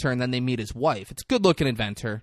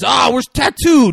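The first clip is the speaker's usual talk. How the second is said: shouting; 13 semitones higher, 12 dB louder than usual. Retold or imitated. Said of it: "Fantasy Island bit".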